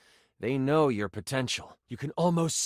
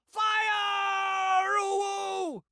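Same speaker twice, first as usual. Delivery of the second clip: high-pitched shout of alarm, drawn out; shrill-pitched war cry trailing into a long holler; acted battle cry; much slower than usual. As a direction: yell it like a lookout sounding the attack. Enemies raise alarm as player charges.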